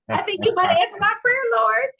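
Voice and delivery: high, loud giddy plea to God; hopeful prayer. Retold or imitated. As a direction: pray it like the dream just walked in.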